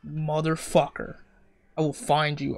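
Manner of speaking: usual speaking voice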